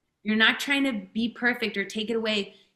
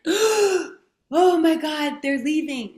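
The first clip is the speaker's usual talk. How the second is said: much higher, louder, very slow cry of panic; panicked alarm; 7 semitones higher, 6 dB louder, much slower than usual. Retold or imitated. Anxious partner as the other walks out, panicked high cry. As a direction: let the fear of being left burst out.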